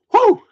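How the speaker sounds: very high, loud whew from strong liquor; burning whew. Real, not acted. Host gasping after a sip of schnapps, a loud high whew. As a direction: blow out the burn of the drink. The schnapps burns his throat.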